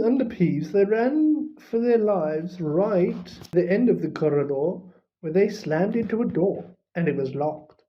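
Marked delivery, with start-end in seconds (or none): none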